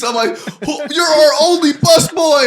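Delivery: high, loud, outraged yelling at a friend; retold panic, hammed up and loud; mock exasperated yell. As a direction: shout it like your only busboy just walked out mid-shift. Yelling at friend who quit.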